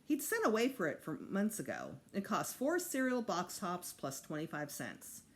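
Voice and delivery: ordinary delivery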